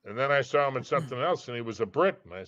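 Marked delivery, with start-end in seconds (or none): none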